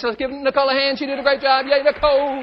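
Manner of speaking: loud, high cheer leading the applause; rally the room to clap for her. Real, not acted. Presenter thanks volunteer Nicole and rallies applause, loud cheering.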